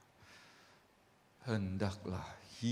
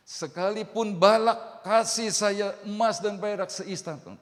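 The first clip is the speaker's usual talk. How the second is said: defiant refusal; 8 semitones higher, 8 dB louder than usual. Acted. Balaam refuses Balak's gold.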